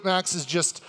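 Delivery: ordinary delivery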